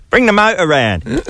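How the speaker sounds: put-on high, hammy Cockney accent. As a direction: toss it off in a stage Cockney. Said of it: "Everyone has to talk Cockney".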